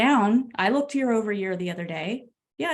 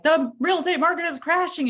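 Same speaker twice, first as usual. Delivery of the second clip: alarmed headline; 8 semitones higher, 3 dB louder than usual. Retold or imitated. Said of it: "it was like".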